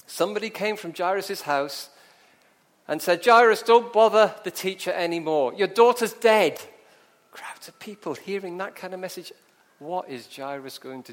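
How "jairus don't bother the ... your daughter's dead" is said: loud, emphatic announcement of bad news; urgent messenger. Messenger from the house telling a father the news, loud and blunt. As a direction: call it out forcefully.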